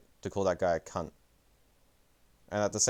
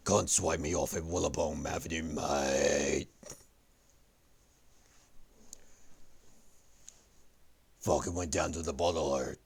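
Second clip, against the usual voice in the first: hammed-up Australian accent; 2 semitones lower than usual. Streamer reading out for viewers, exaggerated Australian accent. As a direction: read it in a badly put-on, broad Australian accent. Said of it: "in my Australian voice".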